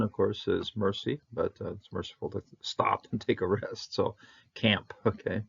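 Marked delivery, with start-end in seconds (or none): none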